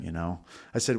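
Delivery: usual speaking voice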